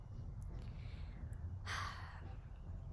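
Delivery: very soft, drawn-out exhale; long sigh. Real, not acted. Storyteller pausing before the moral, sighing. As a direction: sigh slowly and quietly.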